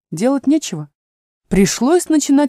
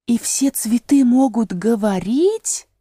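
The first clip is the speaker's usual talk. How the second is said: ordinary delivery, nothing marked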